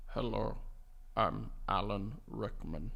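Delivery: deep, slow, quiet, frog-in-the-throat impression; Alan Rickman. Second try after clearing the throat, low and slow. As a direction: sink it low and draw it out slowly. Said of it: "with a frog in his throat".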